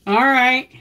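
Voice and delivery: high, loud call to another room. Real, not acted. Calling to her husband Howie about a photo, loud.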